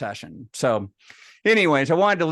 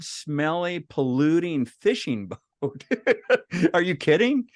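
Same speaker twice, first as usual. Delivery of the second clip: higher-pitched mock indignation; indignant disbelief; 5 semitones higher than usual. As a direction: scoff at the brother's idea in a run of questions. Acted indignant refusal to brother.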